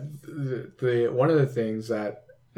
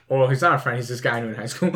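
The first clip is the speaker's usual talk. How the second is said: ordinary delivery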